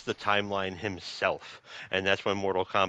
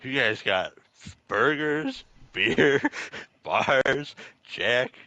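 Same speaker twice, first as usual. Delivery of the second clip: louder, higher, laughing sales pitch; Kano's reasons; 4 semitones higher, 5 dB louder, slower than usual. Retold or imitated. Host voicing Kano listing Earthrealm perks to cohost, giddy and loud. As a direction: list the perks like a giddy hustler. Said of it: "And he's like".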